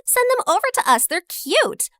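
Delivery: high and quick, gushing; eager giggly. Acted. Lauren tells the waitress to send the boys over, gushing. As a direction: rush it out, thrilled.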